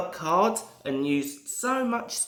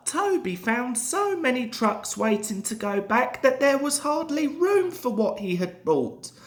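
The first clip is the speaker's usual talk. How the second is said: usual speaking voice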